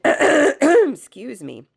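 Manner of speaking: croaky, slow crone-like rasp; hoarse crone voice. Real, not acted. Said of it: "is your crone voice trying to come through me".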